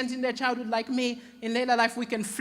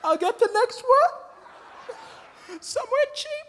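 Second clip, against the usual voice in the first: high, halting, squeaky and drawn-out offer; strained awkward offer; 10 semitones higher, much slower than usual. Retold or imitated. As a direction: force the offer out, pitch climbing. Embarrassed retold reply at the bill.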